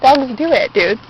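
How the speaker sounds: ordinary delivery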